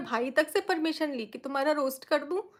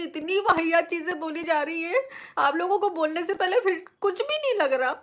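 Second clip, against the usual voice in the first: outraged; 2 semitones higher than usual. Real, not acted. Outraged at reaction channels.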